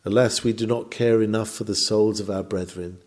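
ordinary talk, nothing marked

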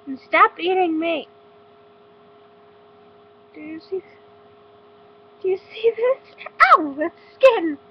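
loud pained protest; yelling as she gets bitten; pained shout. Real, not acted. Bunny biting her.

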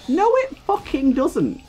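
slow, high-pitched indignant denial; exasperated rebuttal. Real, not acted. Exasperated rebuttal of retold suggestion.

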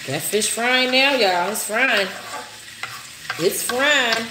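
delighted the fish is finally sizzling; excited. Real, not acted. Telling viewers the fish is frying, excited.

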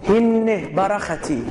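هینه برختی read slowly, each syllable held; careful Hebrew quote. Shown as a demonstration. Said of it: "عبریه".

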